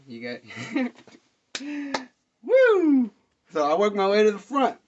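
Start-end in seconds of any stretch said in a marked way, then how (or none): 2.4-3.1 loud, high-pitched "woo"; big soaring holler; crowd whoop. Retold or imitated. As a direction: let out a concert whoop. Re-enacts being in the crowd.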